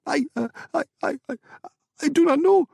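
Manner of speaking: high, stuttering panic; stammering in fear. Acted. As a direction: stammer out, terrified. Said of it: "the owner stammered".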